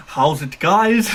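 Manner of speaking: imitated YouTuber's accent, punched up at the end; Davey FPL accent. Streamer mimicking a fellow YouTuber's greeting, put-on accent. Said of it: "You're actually doing Davey F P L accent?".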